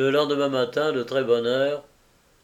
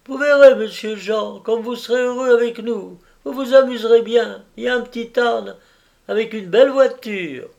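much higher and louder, bubbling with cheer; eager nurse promising fun; excited promise; 10 semitones higher, 7 dB louder than usual. Acted. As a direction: sell the countryside to a little boy with delight.

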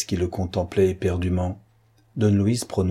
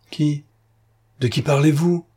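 higher, distraught questions; bewildered; 7 semitones higher, 3 dB louder than usual. A narrator's reading. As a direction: ask lost and bewildered. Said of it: "demanda Patrice avec égarement". (questions égarées, plus aiguës)

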